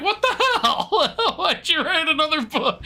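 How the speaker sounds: high, slow, laughing disbelief; mock outrage. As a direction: squeal it out in amused shock. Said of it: "everyone was like".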